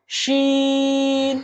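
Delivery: শিন chanted with a long level vowel; elongated letter. Shown as a demonstration. Teacher models for repetition, শিন drawn out. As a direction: stretch the middle of শিন on one note. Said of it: "বলুন আমার সাথে".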